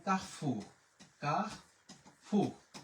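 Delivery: কাহফুর said slowly with a throaty French r; French pronunciation. Shown as a demonstration. Teacher showing students the French shop name, French pronunciation of কাহফুর. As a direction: say কাহফুর the French way, drawn out in two parts. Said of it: "ঠাস করে আমরা বলে ফেলি".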